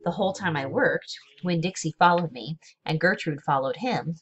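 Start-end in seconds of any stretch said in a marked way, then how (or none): none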